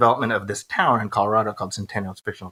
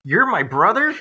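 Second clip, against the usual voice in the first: higher, laughing, rising question; laughing mock surprise; 8 semitones higher, 3 dB louder than usual. Host replays the brother reveal to cohost, laughing surprise. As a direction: gasp it out through a laugh.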